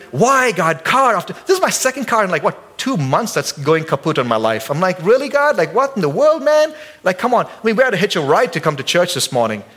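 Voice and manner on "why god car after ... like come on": higher whiny mock complaint to God; hammed-up exasperated whine; sulking complaint. Believer sulking at God over the broken car. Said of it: "sit and sulk and be like".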